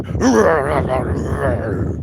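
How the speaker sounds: short mock monster growl; angry growl. Answering a friendly hello with an angry growl. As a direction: snarl back at the greeting. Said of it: "Just wake up angry".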